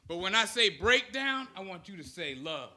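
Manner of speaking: ordinary talk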